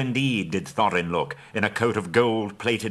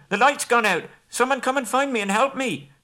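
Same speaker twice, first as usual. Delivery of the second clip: high, loud desperate call; calling for help; 5 dB louder than usual. Acted. Bilbo alone in the dark calls the dwarves to help, loud and high. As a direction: yell for someone to find him.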